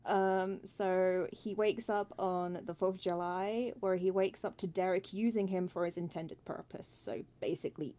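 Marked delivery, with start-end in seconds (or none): none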